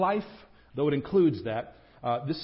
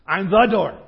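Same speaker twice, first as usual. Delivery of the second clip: loud, punchy insistence; definitive; 4 semitones higher, 11 dB louder than usual. Retold or imitated. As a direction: hammer it home as the final word. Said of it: "He's saying this definitively".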